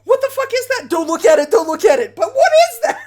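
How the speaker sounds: a terrified man yelling not to look; freaked out. Acted. Frightened man yelling at investigator Maya, freaking out. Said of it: "he is completely freaked out of his mind".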